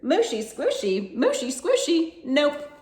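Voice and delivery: high, playful little pig; high piggy voice. A narrator's reading. Piggy answering her family in a high cheeky voice. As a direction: sing it up high like a cheeky piglet. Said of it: "But the piggy answered".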